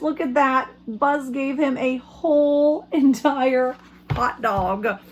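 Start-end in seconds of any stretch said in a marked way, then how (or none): none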